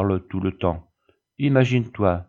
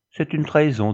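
higher-pitched, understated indignant conviction; 7 semitones higher than usual. A narrator's reading. Luce judging Lola's betrayal, with conviction. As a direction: declare it with conviction. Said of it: "dit Luce avec conviction".

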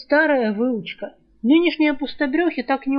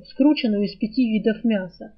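ordinary delivery

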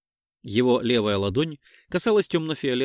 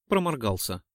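ordinary delivery, nothing marked

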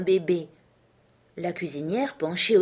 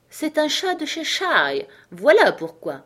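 lightly voiced haughty duchess; slow and imperious as the duchess; a hint of a grand old lady; 7 semitones higher, 4 dB louder than usual. A narrator's reading. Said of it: "répondit la duchesse".

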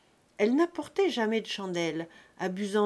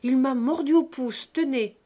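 loud accusation; 5 semitones higher, 8 dB louder than usual. A narrator's reading. Brat accuses Hilarion.

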